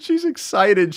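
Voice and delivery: high, giddy mock delight; mock excited. Teasing the listener about her excitement, giddy and high. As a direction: tease her giddiness with a squeaky lilt.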